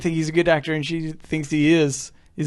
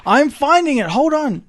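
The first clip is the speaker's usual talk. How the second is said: defensive insistence; 9 semitones higher, 5 dB louder, slower than usual. Real, not acted. Insisting while searching, defensive.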